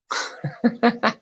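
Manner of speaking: high-pitched burst of laughter; helpless high laugh; real laughter. Real, not acted. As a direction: crack up laughing at a comment. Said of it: "I'm laughing".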